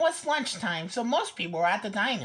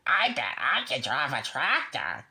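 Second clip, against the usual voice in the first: low, far character voice for Duck; 11 semitones lower than usual. A narrator's reading. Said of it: "he said".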